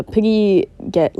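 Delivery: usual speaking voice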